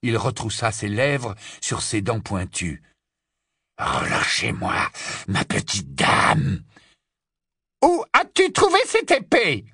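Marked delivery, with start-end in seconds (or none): 3.8-6.6 slow, low, rasping growl; raspy werewolf. Acted. Kneeling Greyback demands release, raspy growl. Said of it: "de sa voix râpeuse". 7.8-9.7 very high, shrill demand; fierce interrogation. Acted. Witch brandishes the sword at Greyback, fierce. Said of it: "répéta-t-elle en la brandissant".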